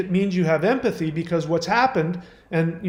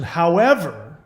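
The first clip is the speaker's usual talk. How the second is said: "However" stretched with a rising tune, slightly louder; drawn-out however; 4 dB louder, slower than usual. Retold or imitated. Host rereading caller's letter, stretched rising "However". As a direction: let "However" hang as the pivot. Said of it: "This is me projecting".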